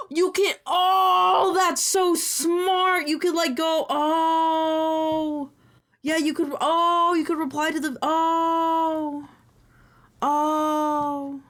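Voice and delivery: high, loud, thrilled; bursting with a great idea; excited. Real, not acted.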